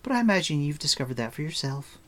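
put-on high, quick girl's voice; chatty girl. A narrator's reading. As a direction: rattle it off as a knowing girl.

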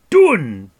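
explosive high, loud outburst; furious shout. A narrator's reading. Furious dwarf throwing the word back, shouting. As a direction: shout it in outrage. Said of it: "he shouted".

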